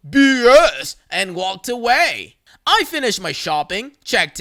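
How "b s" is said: grumbled. Retold or imitated.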